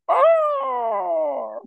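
very high, drawn-out wailing "oh"; hammed-up falsetto cry of an affronted Brit; mock British outrage. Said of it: "the one where people are like".

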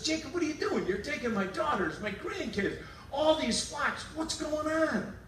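high, demanding father-in-law; Laban confronting Jacob. Retold or imitated. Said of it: "He chases Jacob and says".

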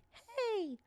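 put-on high, soft kid voice; small, high, distant mock scream; imitated child's scream. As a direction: mimic a child calling from way off. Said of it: "it is a kid".

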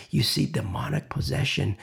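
hushed, conspiratorial whisper; urgent whisper. Real, not acted. Preacher warns the congregation in a whisper. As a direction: lean in and whisper it. Warning about church.

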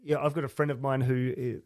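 normal talking voice

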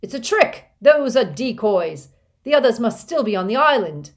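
high, loud alarmed yell; villain screaming to his recruiters; crying out. A narrator's reading. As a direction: shout the alarm across the bridge. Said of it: "and cried".

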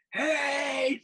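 high, loud imitated yell; Johnny Haidt yelling. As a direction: yell it out as the friend did. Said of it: "he yells".

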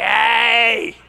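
loud playful shout; excited shout. Pretend. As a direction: yell it like the seal. Seal voiced photobombing penguins.

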